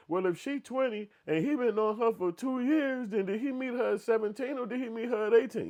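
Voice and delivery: high, loud questioning voice; imitating his lady. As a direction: mimic his partner poking holes, pitched high. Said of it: "she going back like this".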